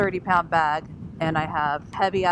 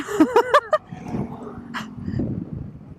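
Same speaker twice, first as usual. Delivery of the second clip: high breathy laugh. Real, not acted. Laughing while riding.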